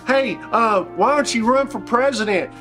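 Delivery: usual speaking voice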